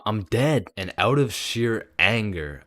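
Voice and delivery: normal talking voice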